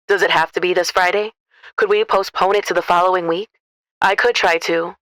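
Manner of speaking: smooth trucker asking a caller a question. A narrator's reading. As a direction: ask it like a friendly working man. Trucker on phone.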